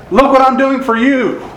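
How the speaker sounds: pitched high, mock self-pitying brag; mock boasting. Preacher mocking a self-praising sufferer, high mock brag. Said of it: "he didn't say".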